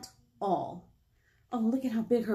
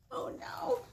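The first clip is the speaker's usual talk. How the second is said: hushed worried whisper; 13 semitones higher, 6 dB quieter than usual. Acted. Librarian worried for the mouse, whispered. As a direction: breathe it out worried.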